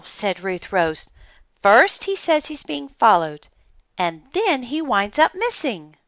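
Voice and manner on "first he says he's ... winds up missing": put-on high, animated, louder girl's voice; keyed-up, high-pitched astonishment; amazed girl. A narrator's reading. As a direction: blurt it out in excited wonder. Amazed girl reacts to letter.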